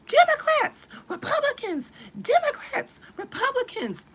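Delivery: huge high whooping shout of party names; crowd cheering. Retold or imitated. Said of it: "the people just".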